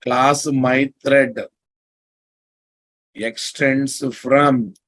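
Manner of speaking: very slow, word by word; slow dictation. Shown as a demonstration. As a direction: dictate code for note-taking. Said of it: "dictating code slowly".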